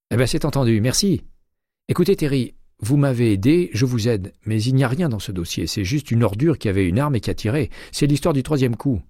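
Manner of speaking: voix de Jay plus aiguë, enjouée; Jay au téléphone. A narrator's reading. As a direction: interlocutrice jouée un ton plus haut, légèrement animée. (Jay, inspectrice, répond à McCaleb au téléphone, voix plus haute)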